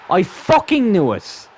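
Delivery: slow, high, loud angry yell; loud, drawn-out exasperated cry; angry outburst. Real, not acted. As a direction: erupt in real anger, dragging the curse out. Gamer erupts at conceded goal.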